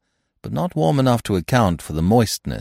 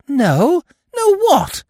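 very high, louder bewildered question; astonished; 5 dB louder, slower than usual. A narrator's reading. Eve pressing Keeble about his secret, high astonished question. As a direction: demand it in high disbelief.

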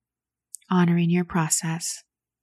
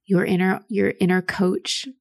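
ordinary talk

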